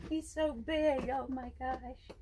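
high, slow cooing over the snake. Real, not acted. Owner cooing at her huge pet python, high and slow. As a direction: coo at him like a proud mom.

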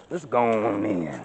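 normal talking voice

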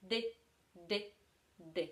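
D, D, D with the tongue on the gum ridge; repeated alveolar D demo; alveolar D sound. Shown as a demonstration. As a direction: tap D on the ridge behind the top teeth. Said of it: "która brzmi tak".

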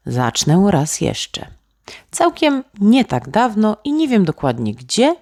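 plain reading voice

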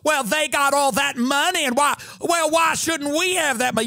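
camp Southern sass, lilting up; sassy Southern. Retold or imitated.

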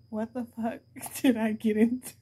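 acted disbelief broken up by laughter; laughing. Real, not acted. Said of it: "I don't mean to laugh".

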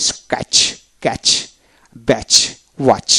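"স্ক্র্যাচ, ক্যাচ, ব্যাচ, ওয়াচ" each ending in ch with no t; spaced word demo, t unsounded in ক্যাচ and ওয়াচ; silent t. Shown as a demonstration. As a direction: read each word apart, closing on a clean ch. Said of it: "এভাবে উচ্চারণ করতে হবে".

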